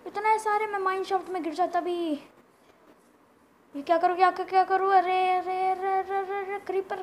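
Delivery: high, loud babble of alarm; flustered panic. Real, not acted. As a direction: stammer repeats as danger closes in. Surrounded by monsters, panics. (घबराहट में ऊँची आवाज़ से)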